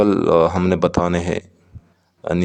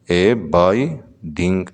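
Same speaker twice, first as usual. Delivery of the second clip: ए biding drawn out slowly; slow syllable demo; much slower than usual. Shown as a demonstration. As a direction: sound out ए biding beat by beat. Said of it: "ए biding में दो syllable". ("ए biding" धीरे-धीरे, syllable अलग)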